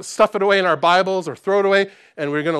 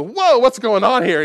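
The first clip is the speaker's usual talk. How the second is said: startled; 7 semitones higher, 7 dB louder than usual. Retold or imitated. Woken by roommate's shouting.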